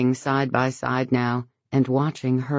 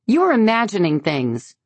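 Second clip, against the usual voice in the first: high, loud dismissal; dismissive; 7 semitones higher, 5 dB louder than usual. A narrator's reading. Lauren dismissing Beverly, high and loud. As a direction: brush her off hard.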